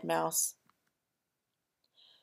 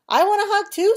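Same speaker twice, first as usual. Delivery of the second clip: eager squeal; 13 semitones higher, 9 dB louder, faster than usual. Acted. Bird eager for hug.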